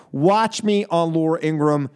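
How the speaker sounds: ordinary delivery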